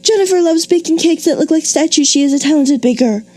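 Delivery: high, rushed run-on read without pauses; gabbling a run-on example. Shown as a demonstration. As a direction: run the sentences together breathlessly. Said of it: "Oh my goodness, what a mouthful of a sentence".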